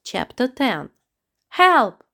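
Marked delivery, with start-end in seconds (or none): 1.5-2.0 high, loud cry for help; scream. Acted. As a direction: scream it out in terror. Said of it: "heard Amita scream".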